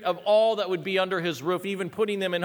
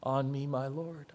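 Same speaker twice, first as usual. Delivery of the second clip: hushed, lowered scripture quote; quiet plea; 2 semitones lower, 6 dB quieter, slower than usual. Acted. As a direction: murmur the plea reverently, slowly. Quiet first take of the plea.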